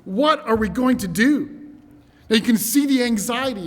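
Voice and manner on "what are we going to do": loud, high, alarmed plea; acted panic, raised voice; anxious cry. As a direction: cry out to your master in dread. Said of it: "you can feel it in his voice".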